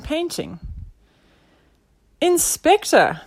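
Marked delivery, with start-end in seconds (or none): 2.2-3.2 loud, high, slow call; sidekick's exclamation; cried out. A narrator's reading. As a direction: hail the inspector excitedly. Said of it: "cried Nelson".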